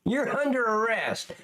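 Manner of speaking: high, mock-amazed sarcasm; sarcastic mockery. Reactor mocks officers announcing the arrest, sarcastic. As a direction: feign surprise, high and drawn out.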